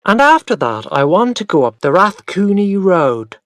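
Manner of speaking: talking over the grown-ups so nothing is forgotten; loud. A narrator's reading. Boy pressing his plans on his mother, loudly. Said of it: "I said loudly".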